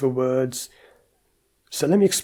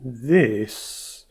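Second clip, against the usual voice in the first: drawn-out, slow and different voice; slow talk demo. Shown as a demonstration. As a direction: stretch the word out slowly. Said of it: "talking like this".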